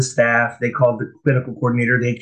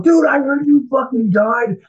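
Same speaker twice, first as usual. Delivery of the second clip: shocked shout. Retold or imitated. Old friend at the treatment center, shocked shout. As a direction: blurt it out in disbelief.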